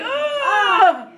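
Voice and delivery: high hammed-up scream; mock birth scream. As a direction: scream like in labor.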